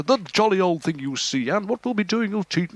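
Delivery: rough attempt at a chirpy radio host; half-hearted sketch of the host; Jimmy Young. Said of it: "Can you do Jimmy Young?".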